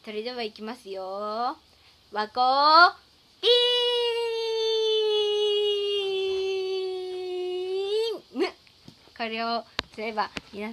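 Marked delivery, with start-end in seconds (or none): 2.1-8.4 drawn-out, higher cry of ビーム; signature beam move. Acted. Streamer to viewers, firing her catchphrase beam with a long cry. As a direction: shout it like an anime attack. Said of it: "最後、あれをやっておきたいと思います".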